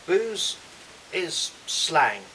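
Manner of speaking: teacher spotlighting Booze; emphatic demo word. Shown as a demonstration. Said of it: "We'll do this nice and slowly".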